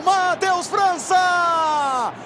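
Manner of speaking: ordinary delivery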